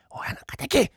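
breathy hushed prompt; prompting whisper. Acted. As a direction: whisper the cue urgently. Prompting a forgotten stage line. (小声でこっそり教える)

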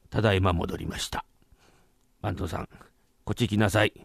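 low, restrained displeasure; stern master. Acted. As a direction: summon the culprit in a low voice. Master confronts caught head clerk.

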